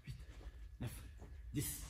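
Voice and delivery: counting reps mid push-up; strained counting during push ups; doing push ups. Real, not acted. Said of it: "On commence par les pompes".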